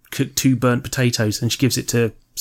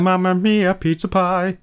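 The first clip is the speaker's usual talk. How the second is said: hammed-up pizza chef singsong; jolly Italian pizza chef; 7 semitones higher, slower than usual. Italian stereotype voice.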